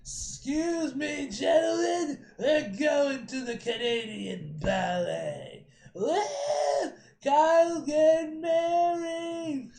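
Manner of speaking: loud, high, drawn-out party holler; shouted announcement. Acted. Reveller announcing the wedding party, whooping shout. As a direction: bellow it slowly to the whole bar.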